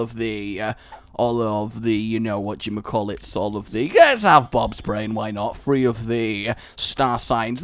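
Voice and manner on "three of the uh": ordinary delivery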